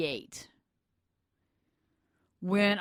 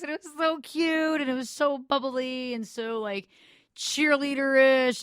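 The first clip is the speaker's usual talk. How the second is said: higher, playful mock cheerleader voice; imitating a cute bubbly voice; 7 semitones higher, 4 dB louder than usual. Recalling her school friends' voices, mock cute and higher. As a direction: put on the cute high voice of the girls she envied. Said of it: "this high-pitched voice".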